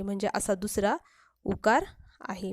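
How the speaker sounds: ordinary talk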